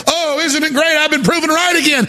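high, smug sing-song gloat; mock gloating. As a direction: gloat like a told-you-so pundit. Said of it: "I'm not gonna be sitting here going".

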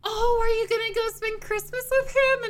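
high, loud, gushing question; eager parents. Retold or imitated. Parents ask daughter about Christmas plans, excited and high. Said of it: "And they're like".

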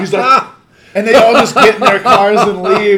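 high, loud words through laughter; laughing. Real, not acted. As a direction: keep telling it while cracking up. Still laughing at the story.

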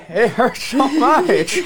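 slow, high laughing groan; pained laugh. Real, not acted. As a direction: laugh through the burning pain. In pain from spicy food.

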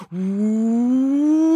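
drawn-out, steadily climbing "whoooop"; long rising whoop. Acted. Storyteller shows nose growing ten metres, long rising whoop. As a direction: slide slowly up to a high note.

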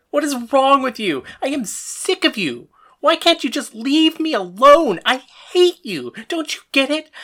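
high, loud, furious outburst; yelling back. Acted. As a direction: yell back at a hated father. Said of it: "I yelled back at him".

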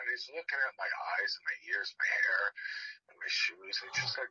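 ordinary talk, nothing marked

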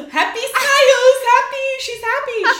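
very high, loud gleeful exclamations; hammed-up excitement. Photographer narrating the bride's reaction, hammed-up excitement. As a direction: imitate an overexcited photographer, shrieking it out.

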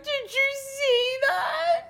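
high, slow teary cry; crying shout. Retold or imitated. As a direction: wail it through tears. Retelling sobbing at graduation.

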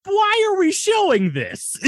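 mock horrified shout; exasperated shout. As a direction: yell it in disbelief. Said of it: "I'm like".

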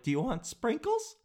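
lightly voiced mock server asking about sprinkles; bored server voice. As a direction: ask it like a server who barely wants to be there. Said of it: "has to ask stuff like".

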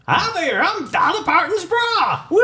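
squeaky cheerful doll voice; talking bra voice. Pretend. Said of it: "you can press a button and it talks".

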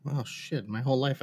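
ordinary talk

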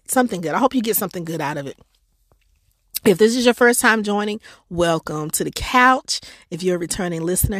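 ordinary talk, nothing marked

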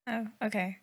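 usual speaking voice

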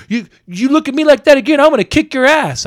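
loud, high imitation of a raging stranger; pissed-off threat. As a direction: threaten a beating as the angry man. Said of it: "he was pissed off at the world".

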